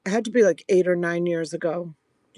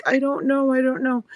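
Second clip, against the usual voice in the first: high, helpless repeated answer; pitched-up mock panic; retold panic; 6 semitones higher than usual. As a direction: re-enact a distressed reply.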